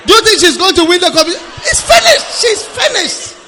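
high, loud and fast mocking shout; excited shout. Real, not acted. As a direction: shout it with glee.

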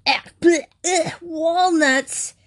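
gag it out like the food is poison; childish disgusted gagging cry. A narrator's reading. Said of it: "he exclaimed in disgust".

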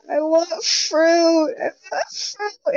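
high, slow sobbing whine for fruit; whiny crying. Retold or imitated. Daughter whining to her mom for fruit, mock crying. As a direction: wail it like a teary kid. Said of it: "I'm crying into my pillow".

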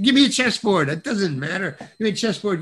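retold frantic request, high-pitched, loud at first; urgent demand. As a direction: grab for it in a mock rush. Actor grabbing a prop in haste.

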